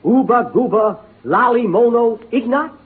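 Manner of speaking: slow, loud, high gibberish; mock jungle language. Clark questioning the native in gibberish, slow and loud. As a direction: sound out the nonsense words deliberately.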